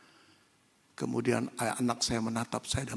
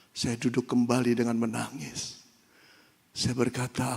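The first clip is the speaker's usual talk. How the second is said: usual speaking voice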